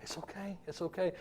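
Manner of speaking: very soft, hushed reassurance; tiny, tender murmur; comforting coddle. Acted. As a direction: soothe a scared dog under your breath. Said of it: "if you're comforting the dog".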